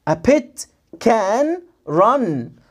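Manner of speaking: very slow, words stretched and separated; slow model reading with long gaps; word-by-word sounding out. Shown as a demonstration. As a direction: stretch each word out for a beginner. Teacher first sounds out word by word.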